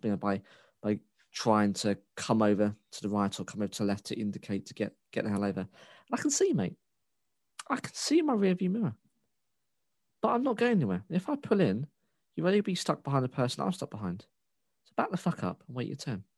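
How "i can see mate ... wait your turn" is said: mock-outraged squeal easing off; exasperated rant. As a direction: plead shrilly with the driver behind, then come down. Acted rant at tailgater.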